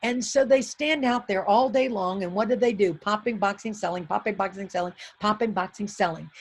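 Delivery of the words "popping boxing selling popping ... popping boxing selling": low, softer monotonous chant; boring routine. Retold or imitated. Popcorn seller all day long, bored low repetitive drone. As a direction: drone through the repeated chores. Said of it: "might call that boring".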